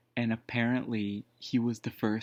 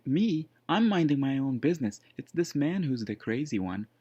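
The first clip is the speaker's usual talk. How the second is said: indignant retelling; 5 semitones higher, 5 dB louder than usual. Retold or imitated. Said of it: "And he said".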